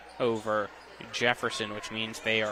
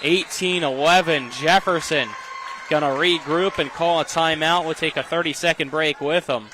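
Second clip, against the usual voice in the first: excited; 4 semitones higher, 6 dB louder than usual. Real, not acted. Wrapping up an exciting rally.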